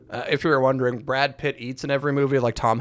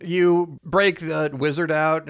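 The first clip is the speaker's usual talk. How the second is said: ordinary talk, nothing marked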